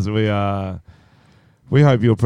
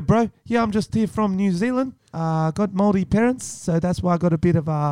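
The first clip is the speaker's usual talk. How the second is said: higher, put-on New Zealand accent; Kiwi accent; 6 semitones higher than usual. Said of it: "pull off Kiwi for an episode".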